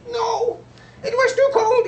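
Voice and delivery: a defeated seagull squeaking out; squeaky seagull. Acted. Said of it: "in his squeaky voice".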